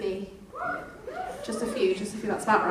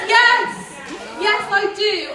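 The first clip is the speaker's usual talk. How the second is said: high, louder burst of recognition; sudden recognition; 8 semitones higher, 6 dB louder, much slower than usual. Real, not acted. Teacher suddenly recognises the girl a pupil mentions, surprised. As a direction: realise who she means and blurt it.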